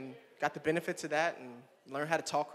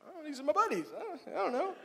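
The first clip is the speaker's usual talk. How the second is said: squeaky nervous reply; high-pitched sheepish brush-off; high mock voice; 9 semitones higher than usual. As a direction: voice jumped way up, timid. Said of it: "I was like".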